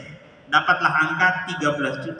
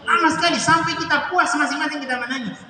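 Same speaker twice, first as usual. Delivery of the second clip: emotional recollection; 8 semitones higher than usual. Real, not acted. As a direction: let the memory of weeping lift the voice. Recalling crying together for minutes. (mengenang tangisan, suara tinggi penuh haru)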